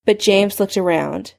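usual speaking voice

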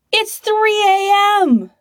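high, loud, slow panicked yell; startled shout. A narrator's reading. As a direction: shriek it in shock. Startled man yells at intruder.

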